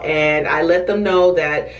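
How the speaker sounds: ordinary talk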